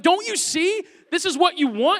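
high-pitched, acted desperation; exasperated pleading. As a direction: plead with a toddler who won't listen. Pleads with his screaming son.

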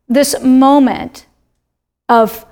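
usual speaking voice

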